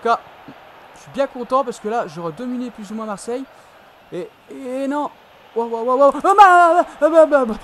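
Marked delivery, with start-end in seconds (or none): 4.1-7.5 loud high alarmed cries; startled exclamation. Real, not acted. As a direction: gasp out at a near miss. Scare near own goal.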